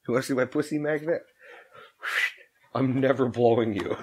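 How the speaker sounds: ordinary delivery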